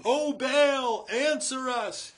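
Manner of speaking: high, loud, drawn-out cry to Baal; shouting. Acted. Prophets of Baal crying to their god, high loud shout.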